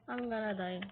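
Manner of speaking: low, soft afterthought; subdued and low; resigned. Pretend. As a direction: drop it right down, quietly. Same words after the scream.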